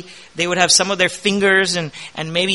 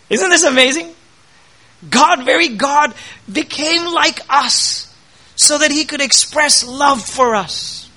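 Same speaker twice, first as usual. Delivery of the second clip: swelling pulpit voice, pushing higher; impassioned preaching; 7 semitones higher than usual. Acted. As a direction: preach it with growing fervour. Preaching at full force.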